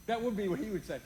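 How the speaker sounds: ordinary talk, nothing marked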